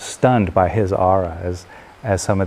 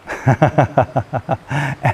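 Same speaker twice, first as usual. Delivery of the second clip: rippling, higher-pitched laugh; 6 semitones higher than usual. Real, not acted. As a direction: laugh at your own joke. Amused at own joke.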